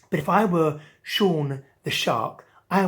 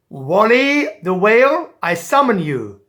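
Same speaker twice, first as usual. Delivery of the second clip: loud, ringing call of a king; calm royal summons; 5 semitones higher, 11 dB louder than usual. Acted. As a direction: summon the whale grandly. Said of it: "remained calm and simply said".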